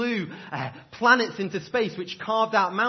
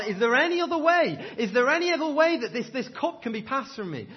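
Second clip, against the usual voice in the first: high, louder pleading cry to the Father; Jesus crying out in Gethsemane, pleading and anguished; 6 semitones higher, 4 dB louder than usual. Retold or imitated. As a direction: beg for another way, voice pushed up high. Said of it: "he's crying out to his Father".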